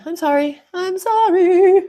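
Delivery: stretched, squeaky mock apology; comic rising apology. Apologising for runny nose.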